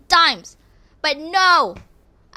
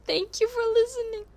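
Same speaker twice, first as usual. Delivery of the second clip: high, soft sign-off voice; cute sign-off; 10 semitones higher, 7 dB quieter than usual. Real, not acted. Saying goodbye to podcast listeners, high and cute. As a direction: chirp the thanks. Signing off cutely.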